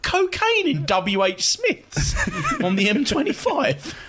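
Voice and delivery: high, slow, bewildered put-on voice; mock baffled shop assistant. Mates on the road mocking a puzzled shop worker, high and slow. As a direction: ham up a clueless cashier hearing odd slang.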